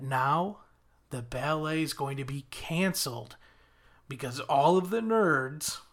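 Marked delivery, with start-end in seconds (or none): none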